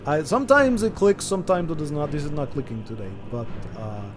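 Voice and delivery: put-on heavy joking Russian accent. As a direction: stiff Russian accent with carefully spaced words. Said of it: "on my worst day of Russian accent".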